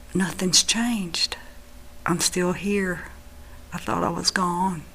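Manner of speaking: soft, subdued realization; retold hushed disappointment; quiet letdown. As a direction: say it hushed, let down to still be here. Retold return from vision, disappointed.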